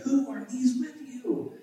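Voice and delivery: lightly voiced, high-pitched questioner; Esau's question. A narrator's reading. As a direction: lift the question well above the narration. Reads Esau's surprised question aloud.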